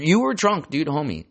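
high, loud teasing call-out. Real, not acted. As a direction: yell it at him, half laughing. Scolding the long-dead grandfather.

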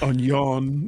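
"onion" in French, on-YON; French pronunciation. Shown as a demonstration. Host joking about pronunciations, French-style "onion". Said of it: "that's French".

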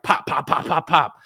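breathy popping gunshot noises; mock gunshots. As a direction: spit out gunfire sounds. Gangbangers shooting in mock scene.

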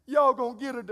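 high, loud mock wail; imitated crying child. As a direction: imitate an upset daughter crying out. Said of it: "and they're crying".

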